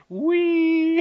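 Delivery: high, sustained joyride squeal; Mike's mock whee. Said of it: "Mike just goes".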